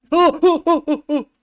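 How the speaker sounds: real shrieks of fright, very high and loud; startled screams. Real, not acted. Streamer jumps at a horror game scare, shrieking. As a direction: yelp in panic at the jump scare.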